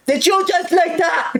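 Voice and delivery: high, slow sneering mock of the teacher. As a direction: drag it out in a snotty high voice like a bratty teacher. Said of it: "Like a fucking brat".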